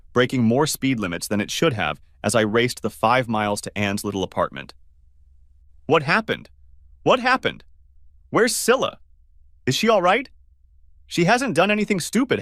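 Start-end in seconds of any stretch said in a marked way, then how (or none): 5.9-12.4 much higher, rushed and frantic; panicked questions. Acted. Ken at Anne's door demanding news, frantic high.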